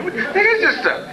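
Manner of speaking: high, slow, disbelieving cry with a laugh; astonished. Acted. George gaping down at hell, high disbelieving cry. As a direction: let it break high in disbelief.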